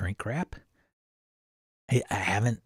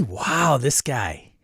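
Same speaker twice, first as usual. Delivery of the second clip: usual speaking voice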